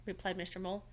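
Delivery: usual speaking voice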